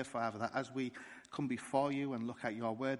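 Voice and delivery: ordinary talk, nothing marked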